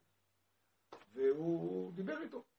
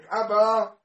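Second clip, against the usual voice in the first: loud, higher call for a father; child crying out; 6 semitones higher, 12 dB louder, much slower than usual. Retold or imitated. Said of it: "כשילד שוכב במיטה וצועק". (צעקת ילד לאבא)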